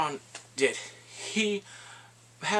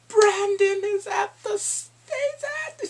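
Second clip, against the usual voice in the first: choked-up squeal; 5 dB louder than usual. Real, not acted. Overcome recapping Bran's return.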